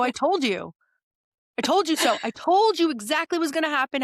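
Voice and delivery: mock triumphant gloating; playful I-told-you-so. Acting out gloating at sister.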